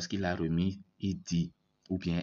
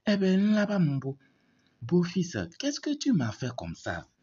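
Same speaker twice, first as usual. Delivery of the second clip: mock cry of pain; 9 semitones higher than usual. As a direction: cry out at the son-in-law as if struck in the belly. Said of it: "Elle réplique encore".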